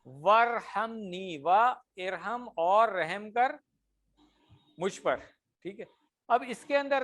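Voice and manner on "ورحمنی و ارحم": ورحمنی and و ارحم stretched out very slowly; teacher slowly taking ورحمنی apart for students; slow Arabic recitation. Shown as a demonstration. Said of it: "مثال کیا ہے؟".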